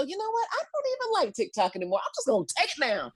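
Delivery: mock president. Comic imagining the president dropping TikTok, high and flippant. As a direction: toss it off like a careless boss. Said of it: "Or the president go".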